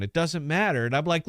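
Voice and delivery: usual speaking voice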